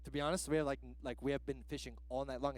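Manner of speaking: very low, soft, flat grumble; sullen fishermen. Retold or imitated. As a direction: drop it low like a sulking crew. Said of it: "they're like".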